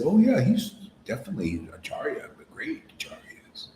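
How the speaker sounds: hushed, mocking whisper; sneering whispered aside; sarcastic whisper. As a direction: whisper it as if it were a ridiculous secret. Mocking guru's claim.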